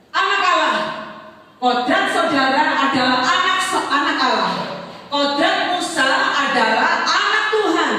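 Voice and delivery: loud, rising preacher's emphasis; preaching at full force. Acted. Pastor proclaiming to the congregation, loud and high. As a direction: drive the point home to the church.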